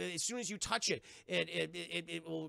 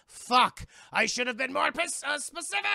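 loud curse rising to a high wail; frustrated ghost; 7 semitones higher, 8 dB louder than usual. Retold or imitated. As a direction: yell it while being dragged away, pitch climbing. Tricked ghost sucked into chest.

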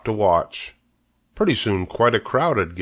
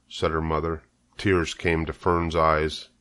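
ordinary delivery, nothing marked

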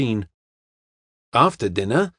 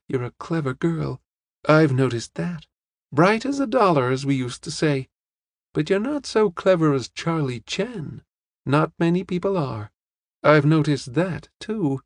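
put-on higher-pitched, matter-of-fact old lady; old lady, candid; 4 semitones higher than usual. Old lady tells the young woman she's clever, candid and higher.

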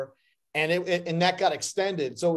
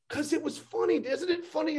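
imitating Danny; 9 semitones higher, 4 dB quieter than usual. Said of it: "And he goes".

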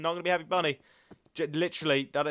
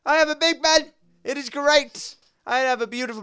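put-on higher, louder, childlike glee; a sing-song brag over the pig pen; excited boast; 10 semitones higher, 9 dB louder than usual. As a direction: show off the pig pen like a thrilled kid. Boasting about the new pig pen.

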